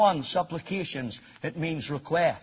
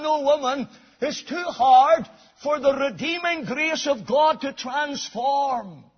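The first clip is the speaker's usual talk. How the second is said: higher, louder proclamation; rising preaching; 9 semitones higher, 7 dB louder than usual. Acted. Preacher proclaiming grace to the congregation, high and loud. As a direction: lift the voice toward grace.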